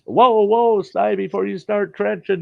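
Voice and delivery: retold warning call. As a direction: shout a warning across the site. Crew member yells warning.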